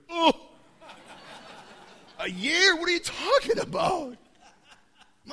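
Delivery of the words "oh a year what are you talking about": high, loud incredulous protest; can't believe what he just heard; shocked disbelief. Retold or imitated. Leader protests being told to pray a year, high disbelief.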